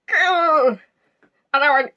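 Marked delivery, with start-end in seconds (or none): 0.1-0.8 a high, playful little growl, like a child pretending to be a monster; mock ogre growl. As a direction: growl like a niece playing ogre. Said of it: "came up behind me and went".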